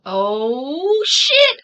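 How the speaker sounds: loud, high-rising burst of shock; shocked outburst. Real, not acted. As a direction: blurt it out as the twist hits you. Host reacts to surprise court moment.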